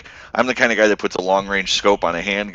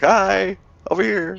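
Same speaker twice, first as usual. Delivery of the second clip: high sing-song mock greeting; taunting wave; 13 semitones higher, 3 dB louder, slower than usual. Pilot waving at baffled enemies, high teasing greeting. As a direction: cheekily taunt from the cockpit, voice way up.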